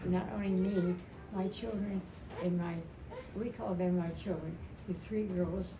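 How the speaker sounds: ordinary talk, nothing marked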